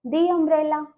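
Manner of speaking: long ee in "the umbrella"; "the" as dee. Shown as a demonstration. Teacher answering own question for students, "the" as "dee". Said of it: "तो क्या बोल देंगे हम".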